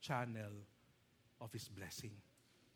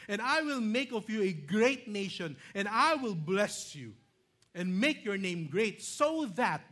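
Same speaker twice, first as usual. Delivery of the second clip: loud, high, forceful preaching voice; emphatic scripture reading; 6 semitones higher, 9 dB louder than usual. Real, not acted. Preacher proclaiming God's promise to Abraham, loud and emphatic.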